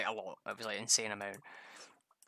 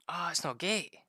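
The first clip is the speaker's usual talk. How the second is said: annoyed surprise; 7 semitones higher, 5 dB louder than usual. Real, not acted. Frustrated at the game.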